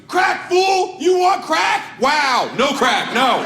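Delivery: high, loud yelling; dealer's angry yell. Retold or imitated. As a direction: snap at him like an angry street dealer. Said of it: "And he goes".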